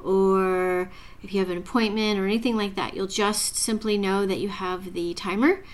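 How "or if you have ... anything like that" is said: normal talking voice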